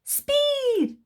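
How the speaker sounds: high, excited squeal of speed; high-voiced speed lover; eager Mister Rush. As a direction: fire it off like a racer. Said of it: "said Mister Rush".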